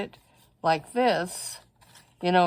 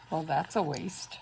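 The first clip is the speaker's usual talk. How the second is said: normal talking voice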